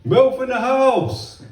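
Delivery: high, drawn-out holler; hammed-up crowing call; imitated heckling shout. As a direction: holler it slowly across a hall. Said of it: "someone shouted".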